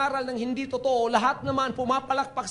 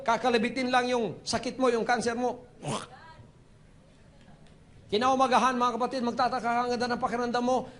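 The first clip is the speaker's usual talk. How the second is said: ordinary delivery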